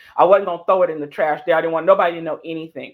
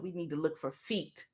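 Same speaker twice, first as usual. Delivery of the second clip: conspiratorial hush; 13 dB quieter than usual. Retold or imitated. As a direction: whisper the plan. Conspiratorial bathroom check.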